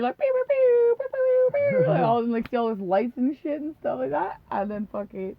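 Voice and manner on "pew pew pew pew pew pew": high squeaky pew pew noises; laser sound effects. Retold or imitated. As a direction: zap out toy laser sounds. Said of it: "we just hear".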